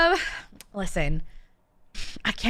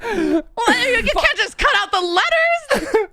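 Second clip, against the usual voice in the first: high, incredulous protest with some laughter; mock outrage; 10 semitones higher than usual. Real, not acted. Guest objecting to British dropped letters, high mock outrage.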